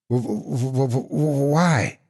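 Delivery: stuttering high terrified why; teeth chattering. A narrator's reading. Terrified Shaky stuttering at the monster. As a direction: chatter the w's in terror. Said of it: "his teeth were chattering".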